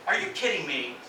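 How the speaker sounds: low, falling, unhappy question; displeased, letting the pitch drop; negative disbelief. Shown as a demonstration. Teacher showing this can be negative, unhappy disbelief. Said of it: "So this can be negative".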